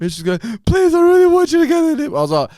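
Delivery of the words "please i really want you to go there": high, loud imitation of begging; exaggerated pleading girl voice; mock pleading. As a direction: mimic her whining plea. Retold girl begging him.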